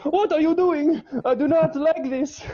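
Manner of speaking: very high mock-outraged father; disapproving dad. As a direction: protest in a hammed-up squawking character voice. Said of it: "and he's like".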